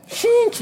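very high playful sound effect; unrolling sound. Retold or imitated. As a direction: squeak it like a whoosh. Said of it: "I unroll him".

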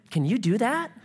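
lightly voiced surfer dude. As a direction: ask it like an amazed surfer kid. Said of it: "talk like a surfer".

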